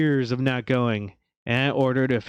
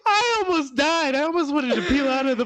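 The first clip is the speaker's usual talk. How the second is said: laughing distress; 10 semitones higher than usual. Real, not acted. Telling the shock.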